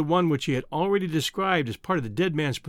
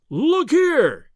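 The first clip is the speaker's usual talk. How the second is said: loud, much higher call; cry; 11 semitones higher, 6 dB louder than usual. A narrator's reading. Professor jabs a finger at the picture, loud and high. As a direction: grab his attention with a loud exclamation. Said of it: "he cried".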